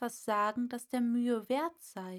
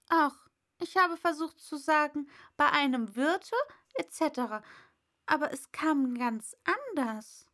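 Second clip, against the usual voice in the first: dejected Alice; 6 semitones higher, 5 dB louder than usual. Acted. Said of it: "in niedergeschlagenem Tone".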